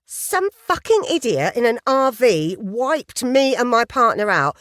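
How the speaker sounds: high, loud indignant gush; eager journalist complaining. Acted. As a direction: vent like the chatty journalist.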